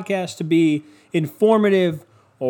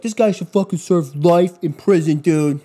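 louder, hammed-up indignant online crowd; louder mock outrage about a killed dog; mock outraged commenter; 3 semitones higher, 4 dB louder than usual. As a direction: play the outraged internet mob. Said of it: "everyone online is like".

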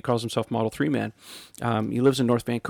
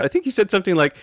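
ordinary talk

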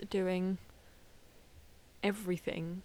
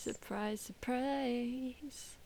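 softer echoed sing-song, rising higher; mock surprise; 3 semitones higher, 4 dB quieter, much slower than usual. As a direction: draw out the second word on a held higher note.

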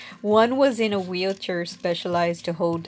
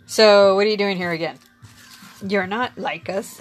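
teasing; 7 dB louder than usual. Retold or imitated. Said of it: "they were such jokers".

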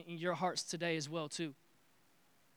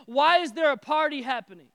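loud, high, indignant question; disturbed demand; 6 semitones higher, 13 dB louder than usual. Acted. Older brother questioning the servant, loud and upset. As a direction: insist on an answer. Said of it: "this guy was so disturbed".

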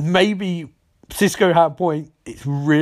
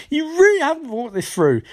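exasperated retort; 11 semitones higher than usual. Retold or imitated. Cisco exasperated at wanting gold gun.

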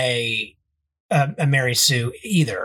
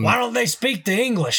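high, cranky Southern codger; grumpy Southern old man; 8 semitones higher than usual. Pretend. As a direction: play a bigoted old grump. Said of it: "we always devolve into that guy".

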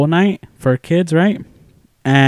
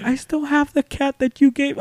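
very high, gentle voice; imitated sweet teacher; 12 semitones higher, 4 dB quieter than usual. Teacher telling former pupil about the cat, high and sweet. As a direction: mimic the teacher sharing a fond keepsake.

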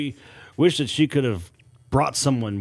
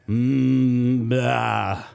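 dry hiss. A narrator's reading. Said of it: "Rosie dryly hissed".

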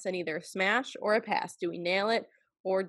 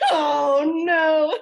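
loud, high laughing "oh no"; laughing dread; 7 semitones higher, 8 dB louder than usual. Real, not acted. Host about to take the turn, laughing dread. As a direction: burst out laughing in real dismay.

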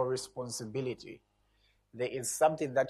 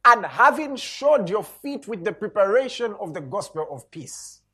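preaching at full force; 5 semitones higher, 7 dB louder than usual. Acted. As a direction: proclaim each line to the congregation. Continues scripture reading.

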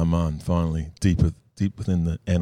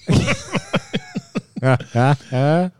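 louder laugh climbing higher; rising laugh; 10 semitones higher, 4 dB louder than usual. Real, not acted. Host scrolling his dirty photos, laughing higher and louder.

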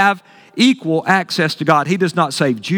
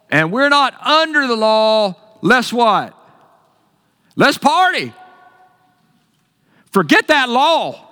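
mock carefree antinomian, higher; 5 semitones higher, slower than usual. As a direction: ham up the party-goer who ditches the law. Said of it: "antinomian way of thinking".

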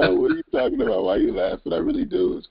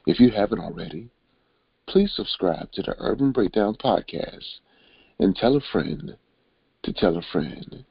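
late-night radio host; 6 semitones lower than usual. Acted. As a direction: croon the intro like a late-night DJ. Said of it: "You putting us to bed or you, you waking us up?".

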